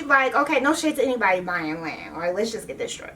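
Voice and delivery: ordinary delivery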